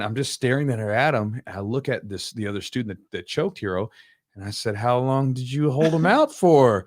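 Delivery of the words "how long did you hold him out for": slow, louder, drawn-out question; pointed questioning. Retold or imitated. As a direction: stretch it out as a pointed demand for an answer. Coach confronts student over the choke.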